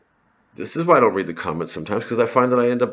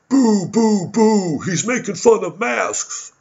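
much higher, louder booing voice; mock booing crowd; 11 semitones higher, 4 dB louder, much slower than usual. Comedian mimics fans now booing his mask jokes. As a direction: jeer like a fickle crowd, pitched way up. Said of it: "And now you're going".

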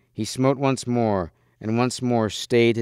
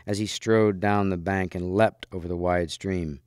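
ordinary delivery, nothing marked

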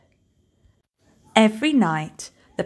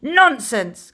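a dismissive, louder scoff; scoffing captain; 6 semitones higher, 5 dB louder than usual. Acted. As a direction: brush off the warning with contempt. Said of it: "Captain Firebeard scoffed".

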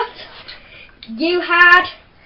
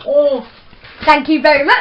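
gloating; 8 dB louder than usual. Real, not acted. Gloating after winning.

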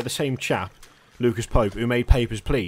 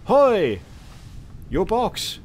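loud high "Hoi!" to get attention; shouted hey; calling out; 8 semitones higher, 4 dB louder, much slower than usual. Real, not acted. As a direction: hail someone across the deck. Calling out to a stranger.

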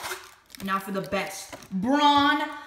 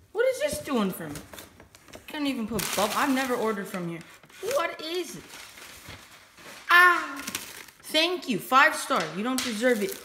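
usual speaking voice

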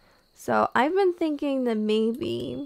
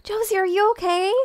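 ordinary delivery

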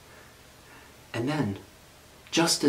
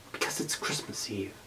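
softer, breathy sad reply; soft sad murmur; 3 semitones lower, 9 dB quieter than usual. A narrator's reading. Sad bunny answering the wolf, soft and downcast. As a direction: barely voice it, downcast.

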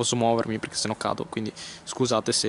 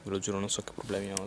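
much softer hushed repeat; 5 semitones lower, 10 dB quieter than usual. Real, not acted. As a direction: drop your voice right down. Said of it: "La gente sta passando".

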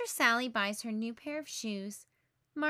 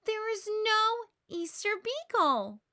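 high, loud, slow insistence; fed-up sister yelling at her brother; angry shout; 10 semitones higher, 5 dB louder than usual. A narrator's reading. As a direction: hammer home that it isn't real. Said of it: "she cries".

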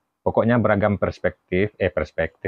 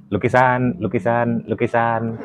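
higher-pitched hawker's call, repeated evenly; mock street-vendor cry; 4 semitones higher than usual. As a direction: playfully call out your wares like a street seller. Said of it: "terus teriak-teriak".

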